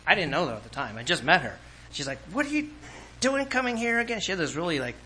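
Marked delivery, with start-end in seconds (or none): 2.3-4.2 high, sneering challenge; mock a rude woman's scornful question; nasty attitude. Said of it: "almost nasty attitude".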